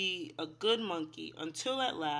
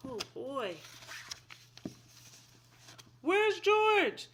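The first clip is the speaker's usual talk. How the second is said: higher, louder mock alarm; dramatic worry; 8 semitones higher, 3 dB louder than usual. Teacher wondering where George went, mock alarm. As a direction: play up the worry for children.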